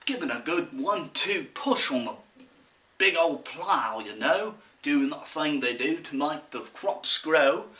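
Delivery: put-on folksy country storyteller in a mixed British-Australian-sounding accent; put-on rural accent. Farm boy recounts a zombie Sasquatch encounter, in a put-on rural accent. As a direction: spin a lively yarn in a thick farm-boy accent. Performs accented monologue, own voice after.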